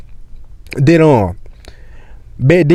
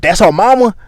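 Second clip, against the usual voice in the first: high-pitched astonished question; retold incredulous reaction; acted surprise; 6 semitones higher than usual. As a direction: repeat the news in disbelief.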